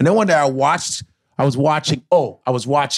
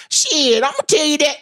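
much higher, showy hack voice; 13 semitones higher than usual. Shown as a demonstration.

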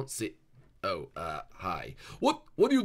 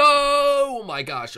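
much higher, louder and slow, drawn out; panicked squeal; 14 dB louder, much slower than usual. Pretend. Hider reacting as the seeker is released, squealing. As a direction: stretch the gasp out in mock panic.

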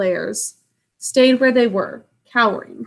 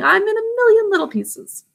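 hammed-up bear complaint; 11 semitones higher than usual. Paraphrasing Baloo, voiced high.

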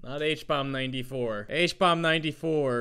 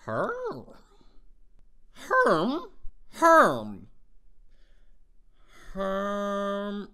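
villager grunt; 3 semitones higher, much slower than usual. Acted. I hate my voice.

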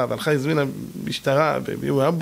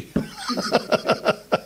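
breathy laugh. Real, not acted. Preacher laughs at own story.

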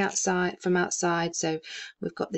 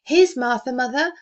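put-on higher, louder girl announcing Martha; girl's announcement; 7 semitones higher, 5 dB louder than usual. A narrator's reading. Girl telling her mother that Martha has arrived, eagerly. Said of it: "said a girl".

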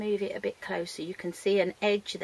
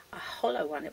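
whisper; 4 semitones lower, 6 dB quieter than usual. Real, not acted. Teacher whispering shape name.

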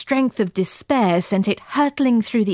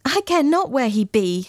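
passionate, higher outburst; impassioned cry; 5 semitones higher than usual. Acted. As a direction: cry it out in longing. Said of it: "she cried".

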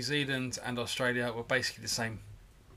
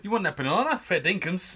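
hammed-up Australian accent, higher and louder; mock Aussie insect; 6 semitones higher, 6 dB louder than usual. A cohost joins in as an Australian bug, mock Aussie accent. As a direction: play the insect as a cartoon Aussie. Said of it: "no, that's Australia".

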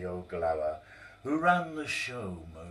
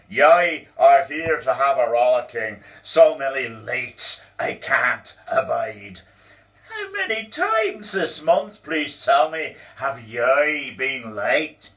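loud, high, slow ranting boss; angry boss; 6 semitones higher, 11 dB louder than usual. Acted. Boss scolding Donald for lateness, loud angry rant. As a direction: berate him at full volume. Boss berating late employee.

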